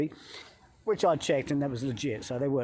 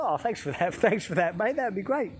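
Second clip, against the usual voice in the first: mock-grateful, lifting higher on the last words; sarcastic thanks; 5 semitones higher than usual. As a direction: laugh through a sarcastic thank-you. Sarcastic thanks for shooting warning.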